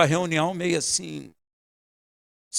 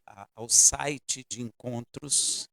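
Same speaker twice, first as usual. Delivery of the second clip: ordinary talk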